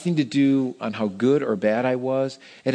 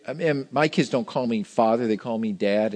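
ordinary talk

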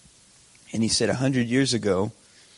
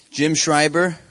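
louder and higher, calling someone out in the crowd; 6 semitones higher, 6 dB louder, slower than usual. Real, not acted. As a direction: hail him across the room playfully. Calling out a man in the congregation.

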